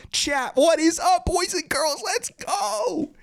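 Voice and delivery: high, drawn-out hype call to the chat; hyped streamer's high-pitched welcome; excited hype greeting. Real, not acted. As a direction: open the stream high and pumped, words stretched.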